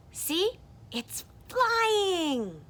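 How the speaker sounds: high, loud character voice; excited insistence. Acted. Character pointing out that it's flying, high excited cry. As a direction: call it out high, stretching FLYING.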